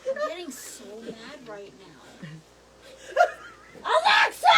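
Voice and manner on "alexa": high, loud, exasperated call; angry shout. Real, not acted. Getting so mad at the smart speaker, angry shout. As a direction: yell the name at a deaf machine.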